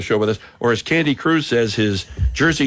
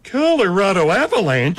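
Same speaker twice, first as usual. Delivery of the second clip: higher, punchy announcer-ish take on the team name; Isotopes-style voice; 6 semitones higher than usual. Acted. Host trying a second take on air, higher and bouncier. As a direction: toss it off in the bright Isotopes voice. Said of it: "Or we can do it this way, like the Isotopes one".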